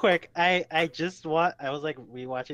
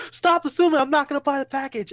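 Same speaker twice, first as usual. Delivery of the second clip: high, loud hammed-up protest; raised, pitched-up mock outrage; mock indignant yell; 11 semitones higher, 6 dB louder than usual. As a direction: act out an exasperated outburst on the phone. Sketch character protests to telemarketer.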